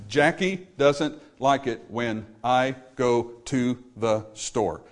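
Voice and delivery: slow, every syllable on its own beat; nine beats. Shown as a demonstration. Rhythm lesson, spreading the line over nine beats. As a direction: tap out nine even beats. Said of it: "That's nine beats".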